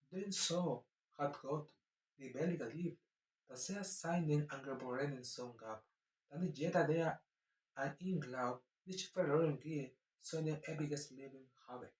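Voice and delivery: gentle, slow scripture reading in German; soft German reading. Shown as a demonstration. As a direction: read the verse gently, trying to sound German. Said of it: "Pero podemos intentar".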